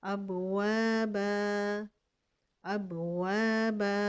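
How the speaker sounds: अबवाबा slowly, ending on a long "aa"; pausal form. Shown as a demonstration. Teacher demonstrates the stop, slowly. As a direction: stretch the last "aa" of अबवाबा. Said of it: "अलिफ मद्दा बनाएंगे".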